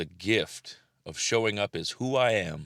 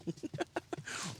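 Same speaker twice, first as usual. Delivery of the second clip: high breathy laugh; 11 semitones higher, 11 dB quieter than usual. Real, not acted. Laughing at the dog.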